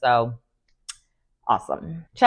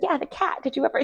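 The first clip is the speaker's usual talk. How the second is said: light, breezy follow-up, a bit higher and softer; casual; 8 semitones higher, 8 dB quieter, faster than usual. Retold or imitated. Drew pressing Britt about the cat, mock-innocent and casual. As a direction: keep it offhand and innocent. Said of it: "She said it so casually".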